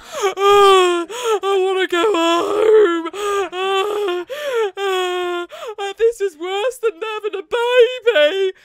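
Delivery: high, loud, slow crying wail; imitated sobbing. Surrounded by strangers after the ride, wailing in loud sobs. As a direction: sob it out between gasps. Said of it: "I couldn't stop crying".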